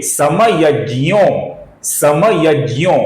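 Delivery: समयज्ञो slowly, ज्ञ as "jy", long O; pronunciation model. Shown as a demonstration. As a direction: draw out समयज्ञो with j plus y. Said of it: "फिर से देखिए".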